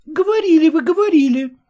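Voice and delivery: a touch of eager accusing; Glafira chiming in. A narrator's reading. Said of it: "подхватила Глафира Семёновна".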